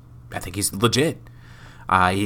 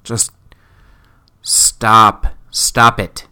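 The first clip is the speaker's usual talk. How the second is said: fed-up groan; 4 dB louder, much slower than usual. Retold or imitated. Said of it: "I just go".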